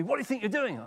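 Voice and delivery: retold indignant police challenge; rebuking. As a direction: voice jumps up high in a mock telling-off. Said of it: "to approach me and rebuke me".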